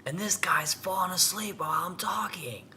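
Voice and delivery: high, loud imitation of a chief yelling; retold yell. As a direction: holler it like an outraged chief. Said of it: "was a chief yelling".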